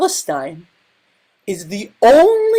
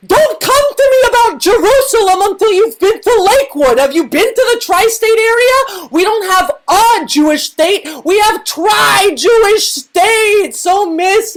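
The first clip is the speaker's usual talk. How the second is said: sassy comic tirade, pitched up; mock indignant rant; 7 semitones higher than usual. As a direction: huff about it like an offended auntie.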